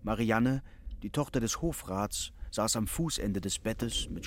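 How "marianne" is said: ordinary delivery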